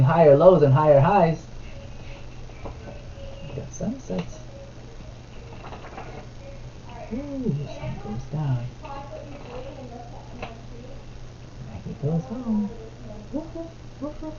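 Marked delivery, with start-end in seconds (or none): 3.5-12.7 soft, hushed bedtime-story voice; quiet picture-book reading to a little one. Real, not acted. Reads picture book to toddler. 13.3-14.3 put-on high, soft puppy woofs for a toddler; gentle toy-dog woof, woof; playful dog woofs. Animal sounds in picture book.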